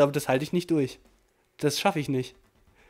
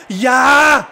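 sudden high shout of joy at the goal; goal cheer; 7 semitones higher, 12 dB louder than usual. Real, not acted. Gamer cheering his own late goal, with a sudden high shout of joy. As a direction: yell it out as the ball goes in.

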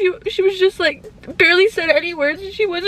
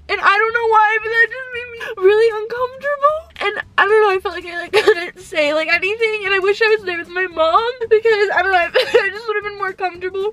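high, tearful voice with catches; real tearful confession; crying; 5 semitones higher than usual. Real, not acted. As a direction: get upset again, words breaking into sobs. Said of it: "Sorry, I'm crying".

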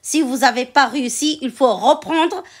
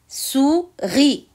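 "Souris" in two separate beats, sou-ris; syllable by syllable; 3 semitones higher than usual. Shown as a demonstration. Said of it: "Je découpe les mots de la fiche en syllabes". (« Souris » découpé en syllabes, sou-ris)

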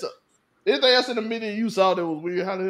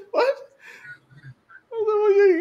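ordinary talk

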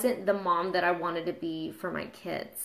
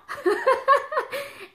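teary laugh; 3 dB louder than usual. Real, not acted. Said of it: "I can't even believe I'm emotional".

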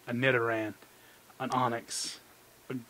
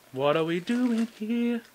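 high, slow, drawn-out whine; whiny plea; 7 semitones higher than usual. Real, not acted. Pack opener begging the Mewtwo pack, high drawn-out whine. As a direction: stretch it out like a begging complaint.

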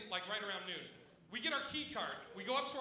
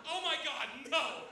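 higher, louder mock denial; imitated accused girl; 3 semitones higher, 3 dB louder than usual. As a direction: protest innocence in a girl's voice. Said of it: "She goes".

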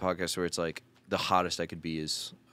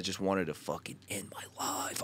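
soft unvoiced whisper; 8 dB quieter than usual. Real, not acted. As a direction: breathe it out as a whisper. Whispered confession.

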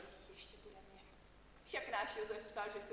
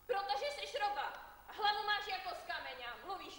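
scolding outburst; 8 semitones higher, 4 dB louder than usual. Acted. Aunt scolds the girl.

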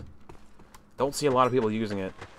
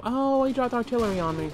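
high-pitched mock whine; imitated victim; 11 semitones higher than usual. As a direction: mimic the enemy's lament, pitched way up. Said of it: "And you will be all".

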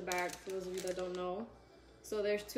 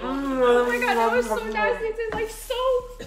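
high, loud, laughing disgust; disgusted shriek; 14 semitones higher, 14 dB louder, slower than usual. Real, not acted. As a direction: cry out at the soap taste. Tasting soap-flavored bean.

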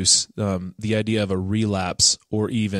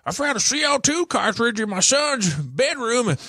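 put-on high, strained old-guy caricature; hammed-up high-pitched older caller; clueless older dad. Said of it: "just older guy who didn't know what he was talking about".

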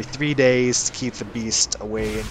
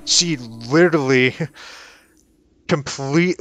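normal talking voice

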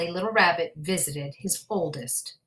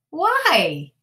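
high puzzled why; 10 semitones higher, 7 dB louder than usual. Acted. Rabbit asking mother.

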